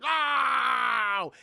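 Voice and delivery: high, loud cry of pain; pained yelp. Retold or imitated. Zod stabbed in the fight, loud high pained yelp. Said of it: "he's like".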